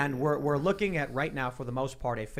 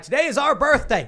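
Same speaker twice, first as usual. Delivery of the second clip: high, loud mock grandstanding; mocking a lawyer's absurd logic, shouted high; mock proclamation; 12 semitones higher, 11 dB louder than usual. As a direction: sneer it like a pompous declaration. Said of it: "could you imagine someone being like".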